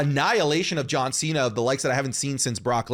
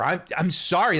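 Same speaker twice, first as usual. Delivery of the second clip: usual speaking voice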